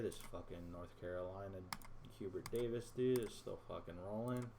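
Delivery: normal talking voice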